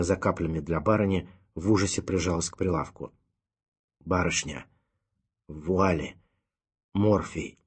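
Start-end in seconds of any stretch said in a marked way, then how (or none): 4.0-4.7 squeezed out between gasps; barely any breath left to speak. A narrator's reading. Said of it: "выдавил сыщик". 5.5-6.2 вуале pushed out on a gasp, falling; out of breath. A narrator's reading. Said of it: "выдавил сыщик". 6.9-7.6 squeezed out between gasps; barely any breath left to speak. A narrator's reading. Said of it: "выдавил сыщик".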